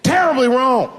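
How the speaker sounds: high, loud alarm. Pretend. Dad realizing something is wrong, high and loud. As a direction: sound the alarm.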